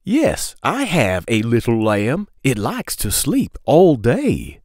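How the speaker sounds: singsong picture-book read-aloud; reading to children. Acted. Storyteller reading a lamb book to kids, singsong. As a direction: lilt the question refrain for little listeners.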